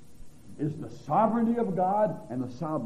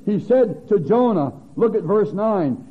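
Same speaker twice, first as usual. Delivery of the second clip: full-force preaching; 9 dB louder than usual. Acted. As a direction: thunder out God's questions to Jonah.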